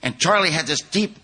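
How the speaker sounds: normal talking voice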